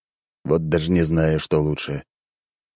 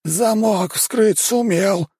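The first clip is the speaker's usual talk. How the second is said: high, slow grumble; grumbling house spirit; much slower than usual. Acted. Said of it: "бурчит домовой".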